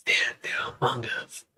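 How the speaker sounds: very hushed, secretive whisper; mock conspiratorial whisper. Host warns that lizard people are among us, conspiratorial whisper.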